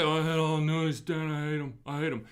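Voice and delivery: a lightly voiced grumbling skeptic; mock grumpy client. Advisor mimicking a skeptical client, mild mock grumble. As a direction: brush it off like a cranky skeptic. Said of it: "So don't say".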